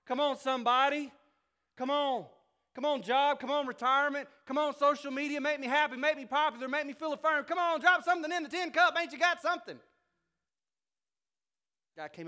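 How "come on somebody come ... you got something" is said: high, loud, pleading beggar's whine; begging with a tin cup. Acted. Heart out in the cold begging the world, whining plea. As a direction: beg the world for scraps. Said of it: "with a tin cup going".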